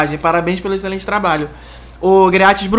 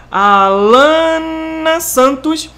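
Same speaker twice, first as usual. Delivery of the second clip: drawn out; 6 semitones higher, much slower than usual. Shown as a demonstration. Slow drawn reading of name.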